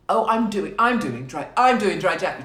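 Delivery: loud, high mock boasting; hammed-up loud bragging; really loud voice. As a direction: announce it at full volume, mocking a show-off. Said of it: "Do it in a really loud voice".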